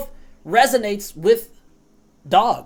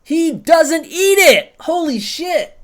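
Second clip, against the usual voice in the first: louder, higher, slow disbelieving exclaim; real shock at a failed roll; surprised outburst; 7 semitones higher, 5 dB louder, slower than usual. Real, not acted. Streamer shocked by the failed roll, loud surprised cry.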